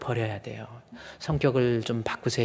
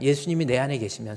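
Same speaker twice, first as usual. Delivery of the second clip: usual speaking voice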